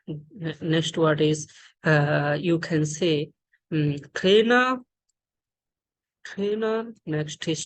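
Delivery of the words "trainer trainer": trainer higher, with a puff of air on the t; aspirated T. Shown as a demonstration. Teacher repeating an example word, aspirated t in trainer. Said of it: "this letter as a ta".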